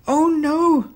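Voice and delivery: high, loud cry of alarm; frightened cry. A narrator's reading. Boy sees the real wolf, panicked cry. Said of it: "cried the boy".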